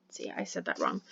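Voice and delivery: a private whispered self-correction; whispered aside. Real, not acted. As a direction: whisper it to yourself, unvoiced and soft. Muttered self-correction mid-lecture.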